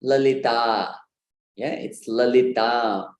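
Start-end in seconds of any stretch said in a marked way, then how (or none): 0.0-0.9 Lalita, short first syllables, long stressed ending; correct long final vowel. Shown as a demonstration. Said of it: "we need to stress and prolong". 2.1-3.1 slow Lalita, stretched stressed final ah; correct long final vowel. Shown as a demonstration. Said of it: "we need to stress and prolong".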